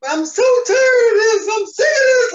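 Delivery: high, loud tearful wail; crying outburst. Retold or imitated. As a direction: reenact a sobbing, fed-up cry. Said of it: "I'm just crying".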